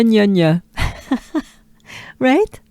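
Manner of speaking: ordinary talk, nothing marked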